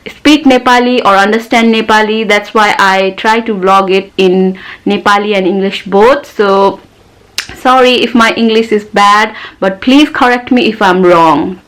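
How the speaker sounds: ordinary delivery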